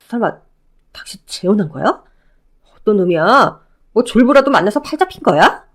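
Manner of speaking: lightly voiced ex-husband, eager and pleading; a hint of a clingy ex overjoyed to see her. Ex-husband calling out to his ex-wife, lightly voiced eager pleading.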